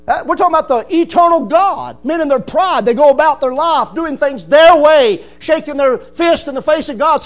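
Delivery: building to a loud high challenge; fiery preaching. Acted. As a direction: rebuke the proud listener. Preaching at full force.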